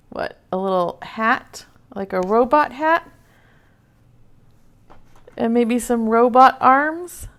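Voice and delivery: usual speaking voice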